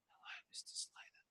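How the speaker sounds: whispered, very soft; a caller whispering a greeting down the phone; soft-spoken caller. Retold or imitated. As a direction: breathe it out barely audible. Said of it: "and talks really soft".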